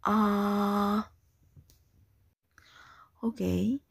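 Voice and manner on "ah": "Ah" sustained without pitch movement; high level tone. Shown as a demonstration. Teacher has class repeat "Ah", steady level tone. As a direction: hold "Ah" long and level. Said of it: "The first tone is very high level".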